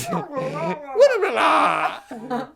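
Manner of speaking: high burst of laughter, rising; loud amused laugh, half a shout; kind gentle laugh. Real, not acted. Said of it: "I'm laughing at you because of the noise".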